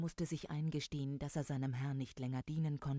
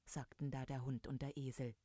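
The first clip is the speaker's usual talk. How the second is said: plain reading voice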